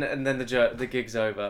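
ordinary talk

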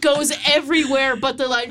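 ordinary talk

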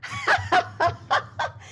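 warm surprised high laugh; kind gentle laugh. Real, not acted. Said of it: "I'm laughing because".

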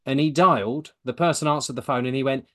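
ordinary delivery

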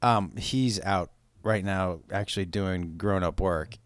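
usual speaking voice

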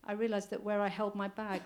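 plain reading voice

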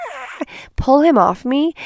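high mock scream for help; retold screaming. Woman telling how strangers saw her attacked, mock scream. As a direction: cry out, acting out the panic. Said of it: "I'm screaming".

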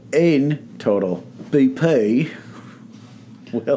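halting, slow attempt at a British accent; bad British accent. Shown as a demonstration. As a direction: try a British accent you can't quite do. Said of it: "read this in a British accent that I'm not very good at".

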